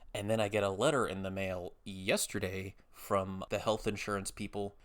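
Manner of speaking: ordinary delivery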